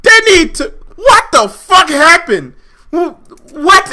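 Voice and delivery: high, loud, exasperated shout; real heated protest; outraged outburst. Real, not acted. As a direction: explode in disbelief. Heated outburst cutting off co-host.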